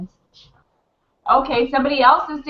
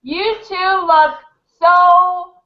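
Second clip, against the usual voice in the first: gushing compliment; 6 semitones higher, slower than usual. Real, not acted.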